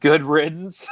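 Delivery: joking retort through real laughter; laughing. Real, not acted. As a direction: toss it out while cracking up. Both laughing at spouses' reaction.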